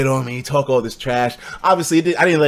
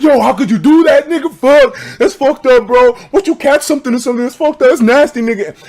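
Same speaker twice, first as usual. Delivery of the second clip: higher, loud grossed-out taunt; mock disgusted bully; 8 semitones higher, 6 dB louder than usual. Classmate taunting me, loud mock disgust. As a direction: sneer it, disgusted. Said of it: "then he's like".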